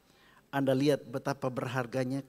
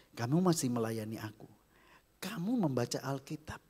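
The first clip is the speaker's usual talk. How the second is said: usual speaking voice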